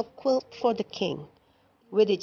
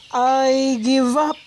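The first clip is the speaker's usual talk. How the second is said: loud, slow, higher exasperated shout; 5 semitones higher, 7 dB louder, slower than usual. A narrator's reading. King giving up to the quilt maker, a loud exasperated shout. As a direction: throw up your hands and shout it. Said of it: "the king shouted".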